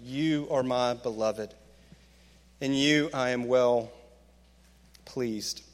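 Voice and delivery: low, slow, gentle assurance; tender blessing. Acted. God speaks tenderly to listener.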